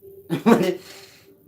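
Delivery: high, loud burst of laughter; laughing at his viewers. Real, not acted. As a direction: bark out a laugh. Laughs mid-rant.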